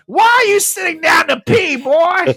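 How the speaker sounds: high, loud, scandalised granny yell; loud mocking old-woman shout; outraged grandma shout. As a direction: screech it at him through the door. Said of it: "She asks him".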